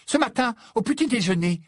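aiguë et pressée; voix perçante. A narrator's reading. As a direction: accuse vite, voix tendue. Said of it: "d'une voix perçante". (Hermione accuse Harry, voix perçante et rapide)

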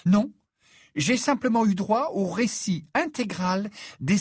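high-pitched exasperated complaint; indignant rant. Acted. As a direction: complain crossly about a self-centred date. Hermione rants crossly about boys.

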